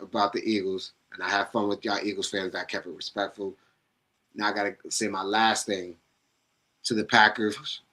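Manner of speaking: normal talking voice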